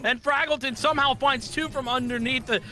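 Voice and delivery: fast, high, hyped-up casting; excited play-by-play. Acted. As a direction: call the action like it's the big moment. Hyped live play-by-play call.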